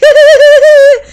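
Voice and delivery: high, loud imitated giggle; mocking girly giggle. Imitating the girl charmed by the boy, a high loud giggle. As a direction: mimic the other girl's giggle. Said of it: "she starts giggling like".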